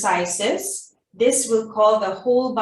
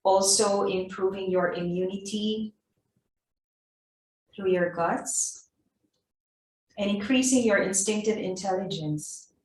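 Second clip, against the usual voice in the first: guided meditation. Acted. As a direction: gently lead them inward. Guided navel breathing meditation.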